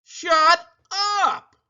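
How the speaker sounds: loud high shout; yelling. Acted. Family loses patience.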